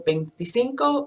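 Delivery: ordinary talk, nothing marked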